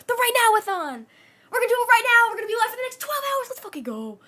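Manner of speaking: high, loud hype shout; hype announcement. Real, not acted. Streamer hypes her twelve-hour marathon to chat, loud excited shout. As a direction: sell the marathon like a ring announcer.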